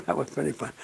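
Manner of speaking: talking to himself. Retold or imitated. Imitating a lone drinker amused by his own joke, softly to himself. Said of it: "telling himself a dirty joke".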